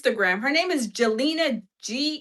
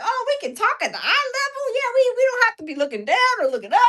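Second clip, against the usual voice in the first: much higher and fast, tumbling out; excited; 13 semitones higher, 4 dB louder, much faster than usual. Retold or imitated. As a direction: rattle it off in giddy delight. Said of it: "I'd be excited".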